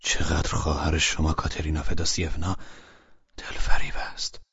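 low, hushed aside to one listener; softly spoken. A narrator's reading. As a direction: say it softly and low, fading away. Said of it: "آهسته به نادیا گفت". (آهسته و زیر لب)

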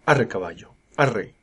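short giddy-up commands; urging a horse. A narrator's reading. Old carter shaking the reins, urging command. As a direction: drive the horse on with a firm call. Driver urges on his horse.

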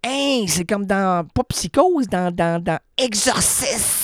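high slow eerie voice; possessed voice. Pretend. Host spooking listeners, eerie possessed voice. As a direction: sound like something out of The Exorcist. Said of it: "un dédoublement de personnalité".

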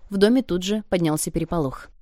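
usual speaking voice

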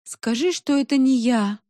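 high, plaintive moan. A narrator's reading. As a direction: plead miserably with a friend. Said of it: "простонала Соня".

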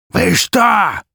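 high, loud hoarse yell. A narrator's reading. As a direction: bellow it out hoarsely. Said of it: "хрипло заорал".

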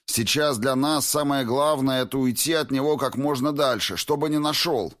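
high-pitched, forceful urging; urgent barking. A narrator's reading. Vitkovsky urges Alyona to flee the enemy, barking urgently. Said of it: "рявкнул Витковский".

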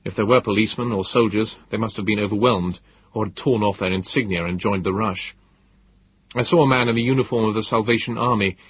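usual speaking voice

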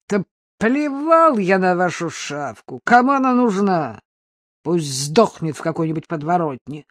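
loud, scornful thug; contemptuous outburst. A narrator's reading. Mugger dismissing their lost dog, scornful. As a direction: spit out contempt at the couple. Monster rejects couple's plea.

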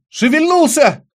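very high, loud, excited cry; sudden shout. A narrator's reading. Will tells friends the thing in the jar moved, shouting. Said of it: "закричал вдруг Уилл".

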